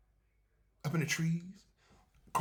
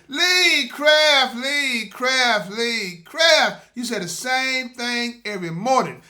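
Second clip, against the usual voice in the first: mock listener complaint; 5 semitones higher, 16 dB louder, much slower than usual. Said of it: "my listener probably saying".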